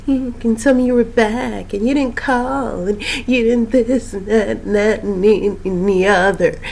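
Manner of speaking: mock her with a wheedling sing-song; Andyisms. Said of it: "the Andyisms".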